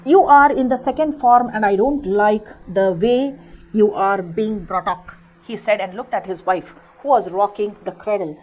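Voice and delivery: deliberate storybook reading for a class; story read-aloud. A narrator's reading. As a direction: read each word out slowly for pupils. Teacher reads story text aloud.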